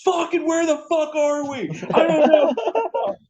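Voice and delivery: high frantic lost-in-the-car yelling, ending in a laugh; high panicked mock scream. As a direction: yell like you're lost at night. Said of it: "you're gonna start screaming that".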